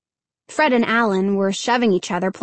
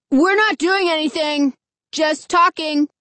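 raised voice calling over; 5 semitones higher than usual. A narrator's reading. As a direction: call out defensively. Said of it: "Fred called to the man".

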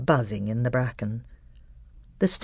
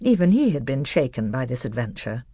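ordinary delivery

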